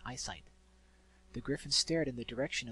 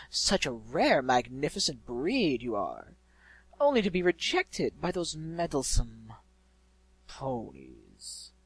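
malevolent, hateful emphasis; 8 dB louder, slower than usual. A narrator's reading. Unseen voice resenting the ponies, grand villain turning hateful. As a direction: flatter grandly, then spit out the last word slowly. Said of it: "The last words had been emphasized with hate".

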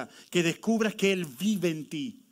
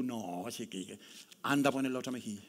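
mock grumble; 7 semitones lower, 7 dB quieter than usual. Imitating a reluctant believer refusing.

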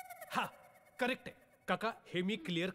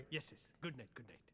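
much softer and lower; hushed goodnight; 7 semitones lower, 12 dB quieter, faster than usual. Acted. Man seeing uncle off for the night, hushed. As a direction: murmur the farewell low.

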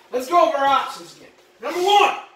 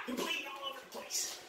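whisper; 20 dB quieter than usual. Acted. Relishing the knife demo.

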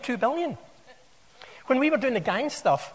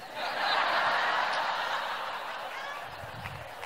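sudden high airy burst of laughter; high breathy laugh; 6 dB quieter than usual. Real, not acted. Speaker laughing at his own risk assessment joke, high airy laugh. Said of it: "I would suggest".

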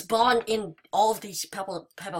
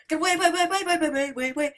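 higher, stuttering panicked repeats; terrified horror victim; 6 semitones higher, much faster than usual. Real, not acted. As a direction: squeal the way way way in panic. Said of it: "the weirdest noise I've ever made".

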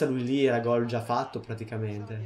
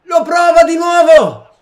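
shouted, much higher, slow and stretched; breathless italian goal commentary; 13 semitones higher, 14 dB louder, slower than usual. Acted. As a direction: call the shot like a TV commentator. Commentating a shot. (telecronaca concitata da gol)

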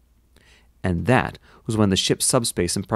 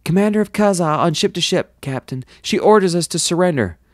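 high-pitched officer relaying a message; urgent bridge officer; 9 semitones higher, 4 dB louder than usual. A narrator's reading. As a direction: report it high and urgent to the captain. Crew officer relays surrender demand.

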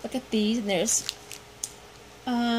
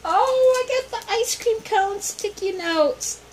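delighted squeal; 10 semitones higher, 3 dB louder than usual. Real, not acted. Unboxing a gift, delighted.

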